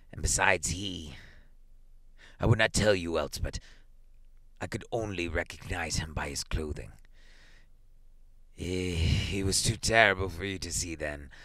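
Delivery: put-on halting, subdued old man; shaken, hesitant grief; shaking voice. A narrator's reading. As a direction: falter through painful words with broken pauses. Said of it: "and his voice shook".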